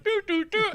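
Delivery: high beeping noise; imitated button beeps. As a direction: beep beep beep.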